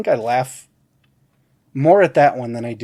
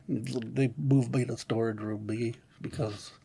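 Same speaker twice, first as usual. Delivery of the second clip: low, quiet mumbling complaint; Milton mumble; 4 semitones lower, 10 dB quieter than usual. Retold or imitated. Milton muttering alone in the storage room, low and quiet. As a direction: grumble it to yourself. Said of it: "Mike Judge doing both voices".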